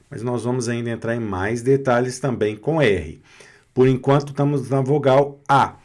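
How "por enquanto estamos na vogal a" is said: ordinary talk, nothing marked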